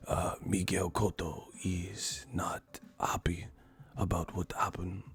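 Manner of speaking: slow breathy whispered boxer talking about himself; Miguel Cotto whisper. Retold or imitated. Comedian mocking Cotto's interview, soft whisper. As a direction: whisper it like a shy boxer on the mic. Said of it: "I'ma start talking like that".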